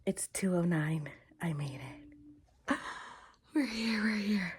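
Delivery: breath-only late-night whisper; hushed whisper. Real, not acted. Telling the camera at two A M they arrived, whispering. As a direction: whisper it with a small gasp of relief.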